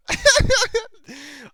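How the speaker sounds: high awkward burst of laughter; embarrassed laugh. Real, not acted. Asking viewers to subscribe, then an embarrassed high laugh.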